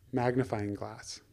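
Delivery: usual speaking voice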